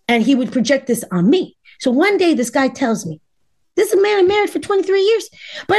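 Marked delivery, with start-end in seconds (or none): none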